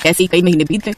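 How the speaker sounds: usual speaking voice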